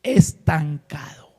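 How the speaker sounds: God telling Elías he is stuck, slow and grave; slow weighty word. Acted. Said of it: "una palabra que le dice".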